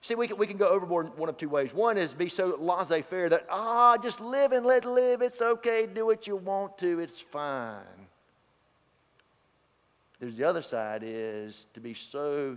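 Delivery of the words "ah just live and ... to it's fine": mock breezy dismissal. Mocking laissez-faire attitude.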